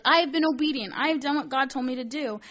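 high, loud, smug self-righteous voice; mocking Saul. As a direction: ham up a proud king bragging to the prophet. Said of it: "He says, like".

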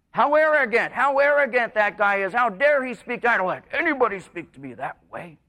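high, loud, offended outburst; mock the listener's wounded pride; indignant listener.

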